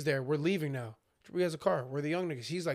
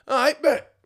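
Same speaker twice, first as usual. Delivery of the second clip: much higher, louder, hyped "bet"; sudden challenge; 10 semitones higher, 8 dB louder, slower than usual. Retold or imitated. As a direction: snap into fight mode. Jabri accepting the challenge.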